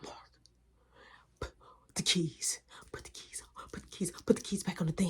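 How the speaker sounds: fast unvoiced hissed instructions; urgent whisper. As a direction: secretly hurry your brother. Son secretly tells brother.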